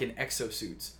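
plain reading voice

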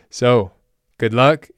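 ordinary talk

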